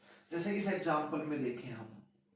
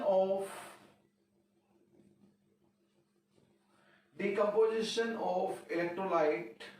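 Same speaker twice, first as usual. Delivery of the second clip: words drawn out for note-taking; slow, deliberate dictation; much slower than usual. Shown as a demonstration. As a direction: dictate it slowly so students can write. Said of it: "teacher dictates definition slowly".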